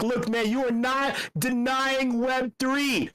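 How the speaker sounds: slow, high, gloating delivery; triumphant retort. Retold or imitated. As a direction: rub it in, drawing each word out. Triumphant retort to door guy.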